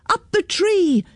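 put-on high, child's rallying call; storyteller's high mock shout; shouted command. A narrator's reading. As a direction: call it out high without real volume. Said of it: "shouted Gemma".

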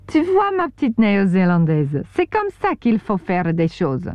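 put-on lecturing in a French accent; a proud French cook teaching the New Zealander, French accent; Lulu's French accent. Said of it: "with an accent I couldn't understand".

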